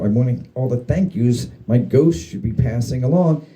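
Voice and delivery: ordinary delivery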